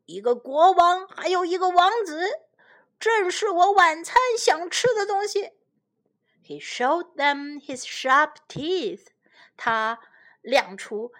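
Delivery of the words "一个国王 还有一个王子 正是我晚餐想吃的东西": a higher, louder lion's roar, hammed up for children. Lion gloating over his dinner, mock roar. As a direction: roar it out like a hungry storybook lion. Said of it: "狮子咆哮道".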